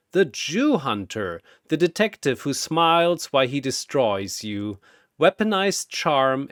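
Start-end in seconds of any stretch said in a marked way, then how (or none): none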